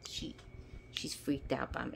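quiet cat aside; hushed aside. Real, not acted. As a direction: drop the voice for a quick aside.

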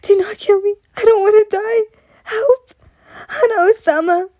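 high, tearful cries for mercy; begging and screaming. A narrator's reading. Girl dragged off to be killed, screaming and begging. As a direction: cry out in panic, high-pitched. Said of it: "begging, kicking and screaming".

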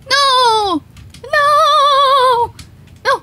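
high, loud wailed refusals; panicked yelling. Real, not acted. Player scared of monsters entering home, panicked wailing. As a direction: cry out long and high in game panic.

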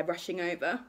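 usual speaking voice

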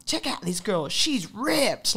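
put-on loud American hype man accent. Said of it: "with this American accent".

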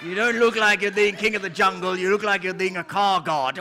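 normal talking voice

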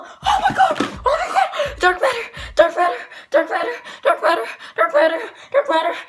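high, loud triumphant yelling; excited screaming. Real, not acted. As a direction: scream with joy at the win. Got Darth Vader camo, celebrating.

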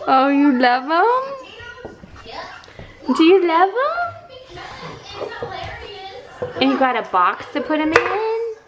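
put-on high sing-song baby talk; reading to a toddler. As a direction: coo to a little one. Cheering toddler's new toys.

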